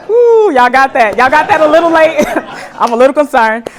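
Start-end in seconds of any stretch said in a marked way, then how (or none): 0.1-2.8 louder, laughing teasing of the crowd; laughing, teasing the audience; laughing tease. Real, not acted.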